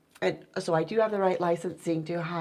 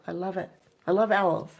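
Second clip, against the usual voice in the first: ordinary delivery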